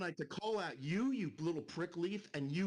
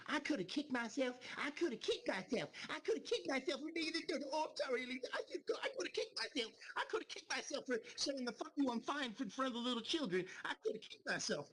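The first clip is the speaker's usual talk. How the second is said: high, whining mockery; mock his self-pity in a whiny falsetto; 8 semitones higher than usual. Ranting streamer imitates a rival's self-pity in a high whine. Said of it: "mocking another streamer's lament".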